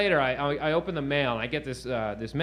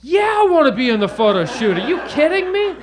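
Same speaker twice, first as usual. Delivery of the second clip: much higher and much louder, bursting with glee; thrilled yelling; 14 semitones higher, 13 dB louder than usual. Retold or imitated. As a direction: shout it like you won the lottery. Thrilled reply to photo offer.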